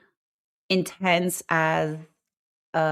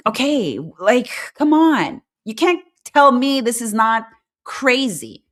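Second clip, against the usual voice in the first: louder, higher exasperated reply; exasperated retort; 6 semitones higher, 6 dB louder than usual. Retold or imitated. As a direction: push back, fed up. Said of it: "I'm like".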